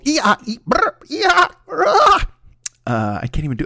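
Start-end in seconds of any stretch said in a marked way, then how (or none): 0.1-2.2 very high squeals and trills, louder; bad dolphin imitation. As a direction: squawk like a strangled dolphin. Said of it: "my imitation of a fucking dolphin".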